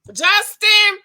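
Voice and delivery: loud high call of a name. Real, not acted. Vlogger calling out to Justin, loud high call. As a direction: shout the name to get his attention.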